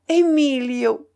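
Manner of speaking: put-on young woman's repeated cry. A narrator's reading. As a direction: draw the name out in a cry. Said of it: "répéta la jeune fille". (appel fort et prolongé)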